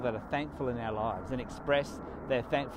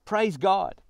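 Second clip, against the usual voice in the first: mild mock shout; 6 semitones higher, 7 dB louder than usual. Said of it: "came back to Jesus shouting".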